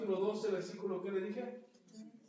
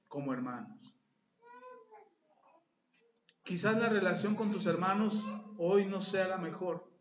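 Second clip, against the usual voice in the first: much louder, fervent preacher closing; preaching at full force; 7 dB louder than usual. Acted. Pastor closing the sermon to the congregation, fervent preaching. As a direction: proclaim it to the whole congregation.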